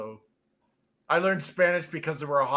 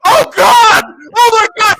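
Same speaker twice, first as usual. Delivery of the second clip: much higher and louder, crying out in disgust; disgusted shout; 16 dB louder than usual. Retold or imitated. Roommate sits on the fart cushion, shouts in revulsion. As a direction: yelp like the stench just hit you. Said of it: "and he goes".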